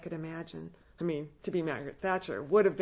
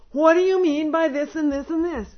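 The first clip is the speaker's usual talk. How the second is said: higher, much louder, baffled protest; exasperated plea; 8 semitones higher, 10 dB louder than usual. Retold or imitated. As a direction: throw up your hands, loudly confused. Pleading with residents, exasperated.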